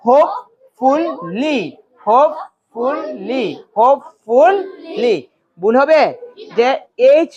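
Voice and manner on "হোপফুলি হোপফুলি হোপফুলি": slow "হোপফুলি" in three beats; each syllable of "হোপফুলি" stretched and set apart. Shown as a demonstration. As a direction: sound out হোপ-ফুল-লি. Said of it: "syllable করে করে পড়ো".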